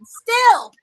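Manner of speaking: loud, punched "Still!"; emphatic insist. Real, not acted. Insisting Kevin Nash still looks good, loud emphatic "Still!". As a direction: hit "Still!" hard to insist.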